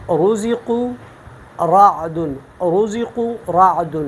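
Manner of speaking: رزق رعد with a full, heavy ر, drawn out slowly; heavy ر in رزق رعد, slow Quranic recitation. Shown as a demonstration. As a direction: say رعد slowly with a thick, velar ر. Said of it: "یہ اب میں نے پر پڑھا".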